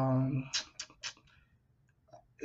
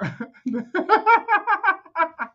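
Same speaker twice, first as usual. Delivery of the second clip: high laugh; 13 semitones higher, 4 dB louder than usual. Real, not acted. Reacting to the clip.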